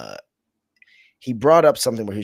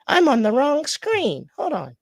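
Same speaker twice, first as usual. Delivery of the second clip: high-pitched, louder outburst; exasperated exclamation; 4 dB louder than usual. Real, not acted. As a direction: exclaim it high at your own mistake. Exclaims at his screen mistake.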